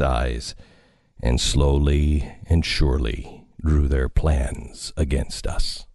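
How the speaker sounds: low, ominous story reading; dramatic narration. Acted. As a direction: read it like an eerie radio drama. Reads War of the Worlds opening.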